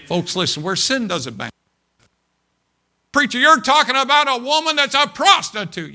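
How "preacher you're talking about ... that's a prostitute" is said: very high, loud mock outrage; hammed-up shocked accusation at the preacher; scandalized objector.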